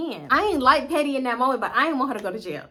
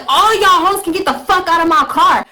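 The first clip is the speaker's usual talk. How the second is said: loud, high-pitched mock eviction; acted angry shout; 7 semitones higher, 10 dB louder than usual. Cousin kicking friends out of car, angry shout. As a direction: yell it like you're throwing everyone out. Cousin throwing everyone out, retold.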